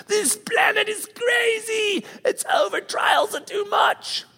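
mock breakdown after a flat tire and a bad day; overwhelmed outburst. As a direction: wail it like the whole world fell on you. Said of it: "you're just like".

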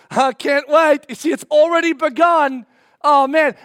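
loud, high, thrilled outburst; eager excitement. Acted. Believer seeing the neighbor sprint up, loudly thrilled. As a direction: think it out loud, bursting with anticipation.

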